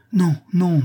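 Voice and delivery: high, loud, agitated cry; agitated refusal. A narrator's reading. Quoted agitated, feverish young woman.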